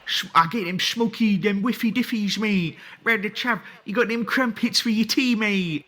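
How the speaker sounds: over-the-top mock Chav accent. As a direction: lay on the Chav slang like a parody. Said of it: "trying to put on a fucking, like, a Chav accent".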